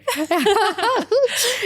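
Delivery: high burst of laughter. Real, not acted. Co-hosts joking over ice cream shop, high laugh.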